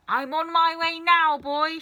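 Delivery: high, loud crowing witch call; grandma calling down from the ceiling; calling hag. A narrator's reading. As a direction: call down triumphantly. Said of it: "she called down to George".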